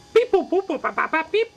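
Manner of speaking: high robotic beeping; robot beeps. Acted. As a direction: chirp like a little robot. App answering as robot.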